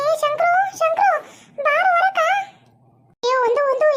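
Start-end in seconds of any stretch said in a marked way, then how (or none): 0.1-2.2 higher, calling his name across the house. Acted. Sister calling Shankru to come outside, calling out.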